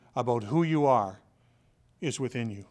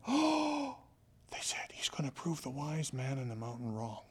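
breathless hushed whisper of the doubting friends; awed whisper; 9 dB quieter, slower than usual. Retold or imitated. Village friends whispering in awe about the boy's dare. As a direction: whisper it like they can't believe the dare.

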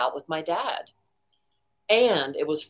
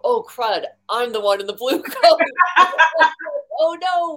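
high, loud and laughing, caught out; laughing embarrassed groan; 9 semitones higher, 8 dB louder than usual. Retold or imitated. Caught out in front of the crowd, laughing dismay. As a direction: giggle through the dismay. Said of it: "I look down and go".

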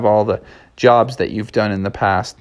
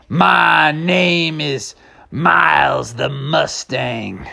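cowboy drawl; 4 semitones higher, much slower than usual. Acted. Horse announces name, slow.

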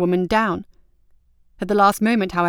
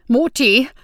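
a sudden, higher, louder call of the name; sharp warning call; 5 semitones higher, 6 dB louder than usual. A narrator's reading. Alwyn cuts off the countess, a sharp, louder warning call. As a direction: snap the name out to stop her. Cutting in to stop quarrel.

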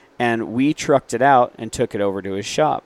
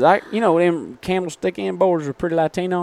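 hammed-up Southern accent, pitched high; 9 semitones higher than usual. Host asked to hear the supplier's Southern accent, so thick Southern accent. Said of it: "Can we hear his Southern accent?".

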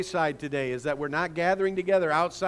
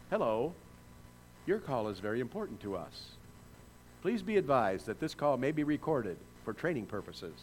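recorded call center greeting; phone menu voice; 5 semitones lower, 8 dB quieter than usual. Retold or imitated. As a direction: read it like an automated phone line. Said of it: "making a phone call to a call service center".

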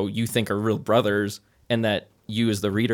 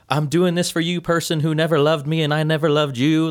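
mocking; 7 semitones higher, 4 dB louder than usual. Mocking Wade's speech to dead aunt.

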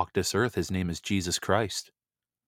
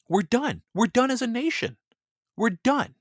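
much higher, pleading cry of alarm; urgent outcry; 13 semitones higher than usual. Real, not acted. Host warning listeners against civil war, much higher alarmed cry. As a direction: sound the alarm, voice jumping up high.

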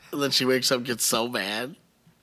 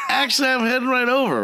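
high, laughing reply; 12 semitones higher than usual. Retold or imitated. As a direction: answer through a laugh.

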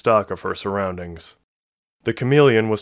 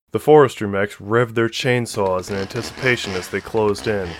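usual speaking voice

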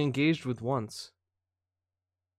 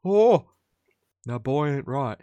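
Hank Hill; 5 dB louder, slower than usual. Acted. Streamer starting up a cartoon impression, self-admittedly rough. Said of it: "I have to start Hank Hill by going".

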